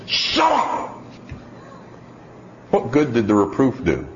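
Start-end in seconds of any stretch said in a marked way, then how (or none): 0.1-0.9 put-on much higher bratty child yell; bratty kid. Toddler in the buggy snapping at his mom. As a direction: snap back like a spoiled toddler. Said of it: "this little kid just leaned forward in the buggy and goes".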